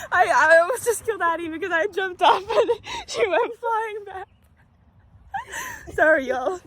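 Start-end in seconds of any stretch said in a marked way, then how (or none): 0.1-4.3 high, giggling through the words; real helpless laughter breaking the sentence; laughing confession. Real, not acted. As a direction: tell it while cracking up. Laughing after knocking Addie off swing.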